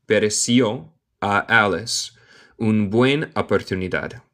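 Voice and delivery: usual speaking voice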